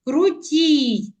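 Крутій with a long stressed тій; drawn-out final syllable of Крутій; stress on second syllable. Shown as a demonstration. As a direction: lean on and stretch тій in Крутій. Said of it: "вимовляємо з більшою силою голосу".

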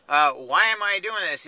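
ordinary delivery

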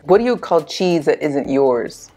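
usual speaking voice

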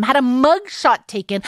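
ordinary talk

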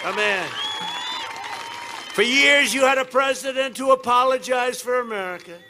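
booming campaign rally delivery; rally speech. Acted. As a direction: shout it to a packed arena.

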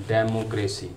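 डेमोक्रेसी as DEM-o-CRAY-see; common mispronunciation. Shown as a demonstration. Teacher showing learners the common error, DEM-o-CRAY-see for डेमोक्रेसी. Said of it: "आमतौर पर डेमोक्रेसी पढ़ा जाता है".

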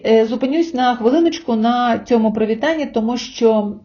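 normal talking voice

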